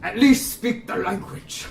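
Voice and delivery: lightly voiced boss taunt; a touch of villain scorn; hint of a sneering boss.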